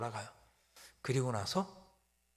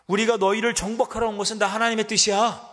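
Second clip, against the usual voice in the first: loud, higher arrogant declaration; mocking taunt; 8 semitones higher, 9 dB louder than usual. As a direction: gloat over the conquest. Assyrian taunt.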